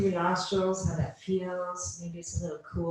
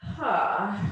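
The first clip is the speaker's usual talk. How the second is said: audible "ha" on the out-breath; open-mouth exhale; 3 semitones higher, 3 dB louder than usual. Shown as a demonstration. Teacher showing students how to exhale, an audible ha. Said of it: "exhale with a ha".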